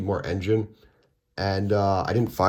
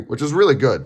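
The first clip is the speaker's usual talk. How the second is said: usual speaking voice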